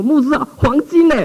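high, alarmed plea about the gold; wife pulls pastor back, alarmed about the gold; anxious wife. Retold or imitated.

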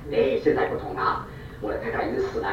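usual speaking voice

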